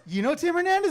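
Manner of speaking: excited surprise. Retold or imitated. Mom amazed her son knows the poet, excited. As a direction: squeal it with amazement.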